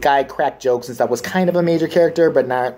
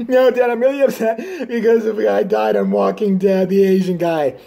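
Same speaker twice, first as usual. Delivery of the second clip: put-on higher whiny child voice; whiny son; 5 semitones higher than usual. A son explaining to his dad why he is sad, whiny. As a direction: whine it like a sulking kid.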